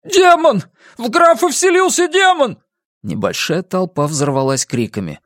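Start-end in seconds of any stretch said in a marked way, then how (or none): 0.0-2.6 very high, panicked shout; high-pitched outcry; cried out. A narrator's reading. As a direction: raise the alarm from the crowd. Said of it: "кто-то выкрикнул".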